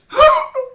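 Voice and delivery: sharp high gasp of fright; startled gasp. A narrator's reading. Girl startled behind the tree, sharp gasp. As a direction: gasp as if caught by surprise. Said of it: "turned around and gasped".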